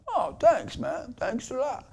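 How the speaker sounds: soft, high, bashful mock reply; touched stranger. Tattooed guy thanking the speaker, imitated soft touched voice. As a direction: answer small and high, as if disarmed.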